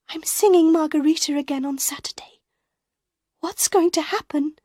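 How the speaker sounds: high, quick hushed worry; fretting under her breath; Christine, anxious. A narrator's reading. Christine worrying aloud to Raoul.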